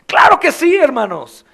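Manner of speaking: high, loud passionate sermon; loud, high fervent pulpit preaching; fiery preaching. Acted. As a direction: preach it hard to the congregation. Preaching at full force.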